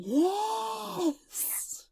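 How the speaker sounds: high, drawn-out yes; thrilled scream, played back for a friend; retold excited scream. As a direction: squeal one long yes way up high. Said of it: "like, screaming".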